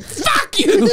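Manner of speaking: shrill-high fuck you through laughter; high mock curse. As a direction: fling it out way up high. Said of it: "him going".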